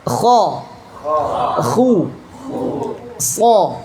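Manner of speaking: thick "So" with the tongue root lifted; heavy isti'la letters. Shown as a demonstration. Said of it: "pangkal lidahnya naik ke atas".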